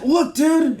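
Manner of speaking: high, eager mock call; retold excitement. Dad on a walk calling his toddler, high and excited. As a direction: act out showing a kid something amazing. Dad showing son bugs.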